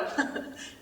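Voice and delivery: a short unvoiced laugh; breathy chuckle. German soldier laughing at the Easter joke, a quiet chuckle. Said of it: "He laughed at that".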